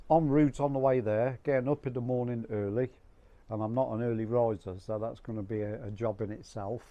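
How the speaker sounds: ordinary talk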